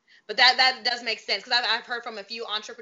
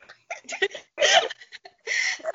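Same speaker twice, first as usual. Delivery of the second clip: high laugh; 3 dB louder than usual. Real, not acted. Host bursts out laughing.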